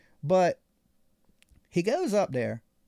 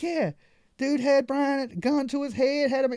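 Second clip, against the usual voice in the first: 1 excited coworkers; 8 semitones higher, 4 dB louder than usual. Retold or imitated. Coworkers retell the robbery.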